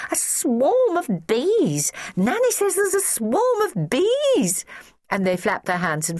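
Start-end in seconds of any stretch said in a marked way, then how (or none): 0.1-4.7 high, drawn-out mock panic; slow high wail of alarm; children crying out. A narrator's reading. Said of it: "cried all the children immediately".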